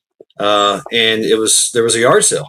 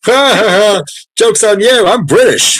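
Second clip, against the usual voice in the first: high, loud mocking laugh-line; gloating Brit; 11 semitones higher, 6 dB louder than usual. British man teasing an American on the Fourth, high gloat. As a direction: crow it like a smug Englishman. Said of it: "And he goes".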